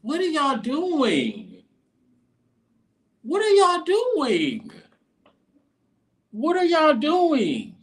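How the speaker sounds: very high, slow, stretched-out disbelief; exasperated falsetto at the team's choice; incredulous whine. Real, not acted. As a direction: whine it like you can't believe them. Exasperated repeated question at Jets.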